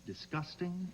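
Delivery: weighing the word Disgusting?, dismissive rise; mock musing, dismissive rising. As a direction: muse over Disgusting? and reject it. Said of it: "Poor word choice".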